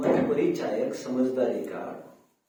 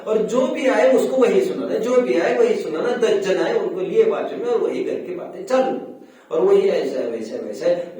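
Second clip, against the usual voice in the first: higher, forceful, piling up the complaints; loud exasperated tirade; 5 semitones higher, 8 dB louder than usual. Real, not acted. Preacher mocking elders' endless home stories, loud and exasperated. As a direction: rail at a tiresome habit.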